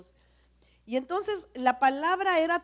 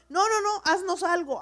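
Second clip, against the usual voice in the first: mock crowd demand; 6 semitones higher, 6 dB louder than usual. People demanding a god from Aaron.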